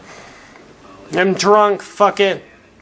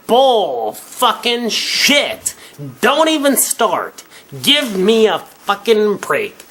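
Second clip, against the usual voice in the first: higher, slow, drawn-out scoff; angry dismissal; 5 semitones higher, slower than usual. Real, not acted. Drunk ranter dismissing women's claims, slow angry scoff. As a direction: spit out each word in disgust. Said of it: "I'm a little bit angry".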